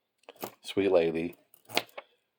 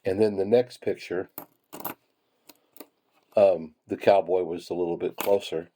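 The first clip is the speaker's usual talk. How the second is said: normal talking voice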